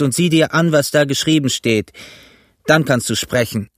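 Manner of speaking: insistent, official demand in a pirate's voice; mutineer George, stern and formal. A narrator's reading. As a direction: lay down the law to the captain.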